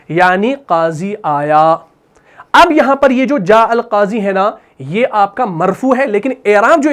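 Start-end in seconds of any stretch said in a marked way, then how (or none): none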